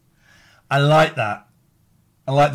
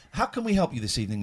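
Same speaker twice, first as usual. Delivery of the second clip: ordinary delivery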